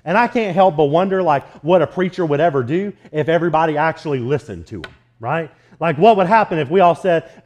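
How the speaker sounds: normal talking voice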